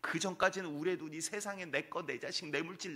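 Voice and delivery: normal talking voice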